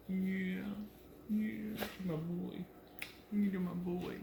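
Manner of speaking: soft cooing voice; talking to a dog. Real, not acted. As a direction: murmur lovingly to him.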